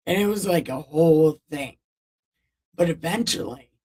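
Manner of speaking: ordinary talk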